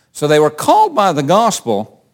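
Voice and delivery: squeaky, breaking voice. Real, not acted. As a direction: voice cracking up high on called. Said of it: "There goes my squeaky, squeaky voice".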